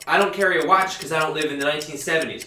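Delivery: ordinary talk, nothing marked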